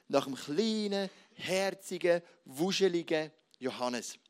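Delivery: ordinary talk, nothing marked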